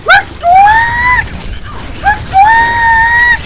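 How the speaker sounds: piercingly high, loud rodent squeals; hamster squeaks. Pretend. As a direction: squeal loudly like an excited hamster.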